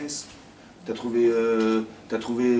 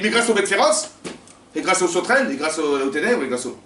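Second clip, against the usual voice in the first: excited thanks; 6 semitones higher, 7 dB louder than usual. Acted. Listing thanks for each plague.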